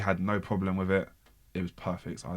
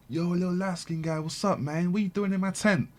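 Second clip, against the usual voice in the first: higher, put-on voice of the star; 11 semitones higher than usual. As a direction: lightly play the star greeting a fan. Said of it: "He's like".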